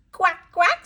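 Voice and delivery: high, loud playful quacks; duck quacking. Shown as a demonstration. Teacher answering what a duck says, quacking. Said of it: "says the duck".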